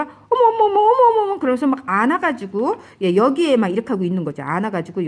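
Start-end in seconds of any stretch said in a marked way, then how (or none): 0.0-1.4 much higher, louder near-sobbing exclamations; retold terror while holding the missionary, high and crying; horrified cries. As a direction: wail in horror as it seeps in. Horror as illness flows in.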